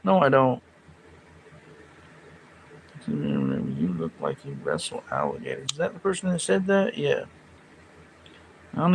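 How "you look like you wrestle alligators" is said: deep, dopey mocking voice; a low, dopey put-on voice; mock dumb voice. Reading a viewer's comment mockingly.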